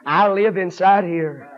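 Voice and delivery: high, shouted sermon building in pitch; full-force preaching. Real, not acted. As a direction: preach it loud, driving phrase after phrase.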